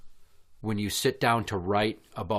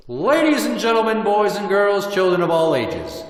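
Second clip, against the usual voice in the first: ring announcer; 10 semitones higher, 9 dB louder than usual. Acted. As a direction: build the hype like a ring announcer. Announces championship challenge.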